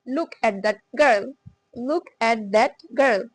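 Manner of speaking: very slow, word by word; slow drill. Shown as a demonstration. Teacher repeating the drill sentence, very slow. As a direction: spell out each word slowly. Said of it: "short".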